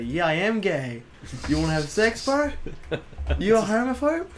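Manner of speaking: playfully provocative, rising in pitch; mock defiant comeback. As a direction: turn the insult back, hammed-up. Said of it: "Then you start to say".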